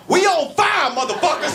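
high yelled warning to the diners. Retold or imitated. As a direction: shout it high across the room. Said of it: "just yelled to the whole dining hall".

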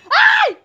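sudden high yelp of fright; startled shriek. Real, not acted. Startled in haunted barn.

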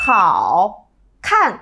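第四声 干脆收尾; crisp fourth tone. Shown as a demonstration. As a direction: end the word the moment the hand chops down. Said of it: "lấy một ví dụ khác".

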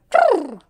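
short, loud lip trill; horse-like lip trill. Shown as a demonstration. Teacher showing children a warm-up, horse lip trill. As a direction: blow through loose lips like a horse. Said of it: "blow through our lips a bit like a horse".

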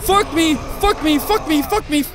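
high, repeated pleas; panicked babble. Real, not acted. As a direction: babble in alarm while running away. Panicking in a chase.

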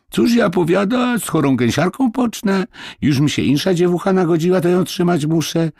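very high-pitched, mock peasant-woman voice; quoted complaining mistress. Boy mimics the mistress refusing sick gooseherd, high-pitched complaint. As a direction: imitate her grumbling up high.

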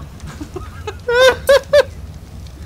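high laugh. Real, not acted.

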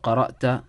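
قرأت said "qara'ta", ending in "a"; rejected "ta" reading. Shown as a demonstration. Teacher rules out a reading, "qara'ta" for قرأت. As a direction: close قرأت on "-ta". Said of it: "There's four ways of reading this word".

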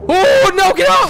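very high, loud yell; panicked shout. Real, not acted. Gamer to attacking enemy, panicked high shout. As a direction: yelp it out in alarm.

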